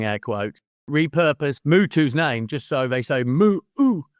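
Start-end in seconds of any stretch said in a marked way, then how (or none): 3.2-4.1 drawn-out "Moo-oo" chanted higher; mock chant sound. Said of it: "in a monkey chant way".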